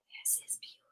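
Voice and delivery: hushed, awed whisper. Real, not acted. Awed by the picture on the page, whispering. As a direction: breathe it in wonder at the page. Awed aside while reading.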